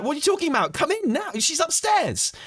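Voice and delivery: high, frantic hurry; urgent, exasperated. Retold or imitated. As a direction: rush her in, pitch climbing. Urging woman to come upstairs.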